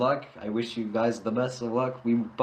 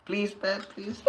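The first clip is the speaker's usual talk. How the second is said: put-on high, small pleading child voice; mock high-pitched begging; begging kid; 8 semitones higher, 5 dB quieter, slower than usual. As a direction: imitate a kid begging in a game. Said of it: "like those kids on Pet Simulator".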